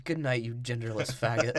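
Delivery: a hint of a bitter mother; mock low sneer. Mom voiced, sneering.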